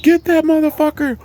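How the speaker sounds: very high acted scream. Servant chasing the carriage thief, high mock scream.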